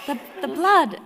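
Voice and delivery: mock horror; shocked. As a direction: repeat it in disbelief. Shocked echo.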